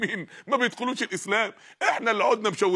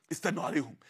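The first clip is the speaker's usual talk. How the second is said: lower, hushed plotter's aside; quiet conspiratorial instruction; scheming patience; 6 semitones lower, 8 dB quieter than usual. Acted. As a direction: drop low and soft, counselling patience. Plotting enemy bides time.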